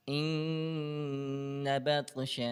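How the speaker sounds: ان بطش with the نون of ان held long and nasal before بطش; slow demo of ان بطش, nasal ghunna held on ان. Shown as a demonstration. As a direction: hum the ن of ان through the nose, slowly, then say بطش. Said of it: "اب میں غنہ کر رہا ہوں، میری آواز ناک میں جا رہی ہے".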